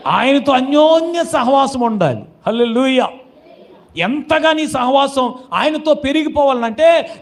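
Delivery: high, impassioned preacher's voice; full-force preaching. Acted. Preacher urging believers to cling to Jesus, impassioned. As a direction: proclaim it urgently, pressing the congregation.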